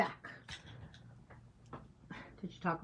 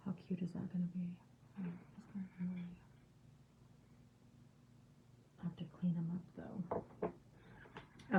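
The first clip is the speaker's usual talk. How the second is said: quick, much softer whisper; hushed and fast, almost to herself; whispering over the little ant; 8 dB quieter than usual. Real, not acted. Excited whispering over the painting.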